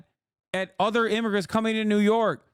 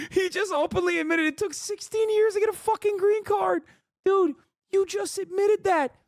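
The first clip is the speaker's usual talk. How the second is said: high-pitched disbelieving rant; incredulous ranting; 12 semitones higher than usual. Real, not acted.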